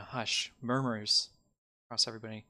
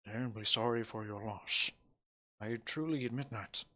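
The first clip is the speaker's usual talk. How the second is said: mayor offering condolences; 6 dB quieter than usual. Acted. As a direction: offer quiet sympathy to a mourner. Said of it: "Turns to you and he goes".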